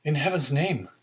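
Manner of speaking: high pleading outcry; desperate cry. A narrator's reading. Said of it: "cried the unhappy young man".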